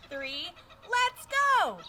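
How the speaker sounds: slow, loud cheer; excited countdown. Acted. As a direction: call it out big to the kids. Counting down with kids.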